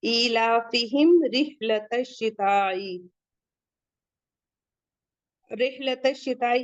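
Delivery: slow chanted tajweed recitation; slow melodic Quran recitation for students. Shown as a demonstration. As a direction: draw each vowel out slowly as in recitation. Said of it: "तो इजहार शफवी होगा".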